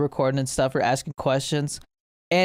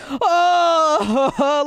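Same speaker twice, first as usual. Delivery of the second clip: pained cry; 11 semitones higher, 4 dB louder than usual. Retold or imitated. Player injured in game.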